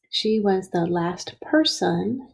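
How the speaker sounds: measured classroom reading; slow reading back. Shown as a demonstration. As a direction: read back slowly, word by word. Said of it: "even though it's not perfectly spelled".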